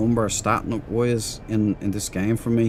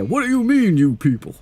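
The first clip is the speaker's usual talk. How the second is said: quoting a movie line with a much higher put-on voice; mock outraged movie quote; imitating Robert Downey Junior; 10 semitones higher, 4 dB louder than usual. Streamer quoting a favourite comedy line to chat, in a high put-on voice. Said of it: "Robert Downey Junior was in that".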